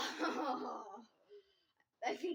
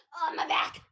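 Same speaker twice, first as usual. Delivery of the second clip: pained yelp; 2 semitones higher, 10 dB louder than usual. Real, not acted. Pain during chug laughing fit.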